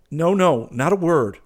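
cried out high and loud, lightly; quick high exclamation, waving the subject away; cry out. A narrator's reading. Hastings cutting his friend off about a girl, crying out. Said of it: "cried Hastings".

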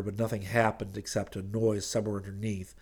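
usual speaking voice

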